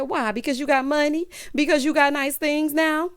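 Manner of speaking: high, indignant challenge; mock accusing questions. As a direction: imitate a jilted woman demanding answers. Retold breakup accusation.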